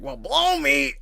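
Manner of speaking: high, loud mock-Popeye outburst; hammed-up cartoon cry; acted cartoon exclamation. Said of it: "and it's like".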